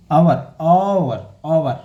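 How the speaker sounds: आवर said slowly with a silent h, starting on the vowel; drawn-out model word. Shown as a demonstration. Teacher modelling आवर for students, slow with no h sound. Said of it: "sound आवर".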